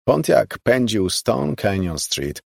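plain reading voice

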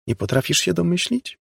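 high, taunting question; mocking guessing-game tone; teasing. A narrator's reading. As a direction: taunt them with a rising lilt. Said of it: "drażniła się z nią niby Peggy".

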